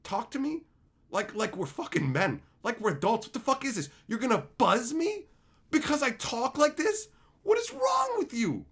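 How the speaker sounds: high-pitched indignant rant; worked-up retold confrontation; exasperated outrage. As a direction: climb to an incredulous, strained peak. Acted outrage at friends.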